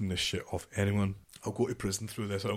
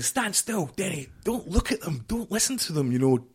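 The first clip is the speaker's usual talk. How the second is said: higher, pressing commands; urgent orders; 8 semitones higher, 5 dB louder than usual. Retold or imitated. Sergeant major urging a soldier to take the discipline, higher and pressing. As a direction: urge him to hold it together. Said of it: "my sergeant major saying".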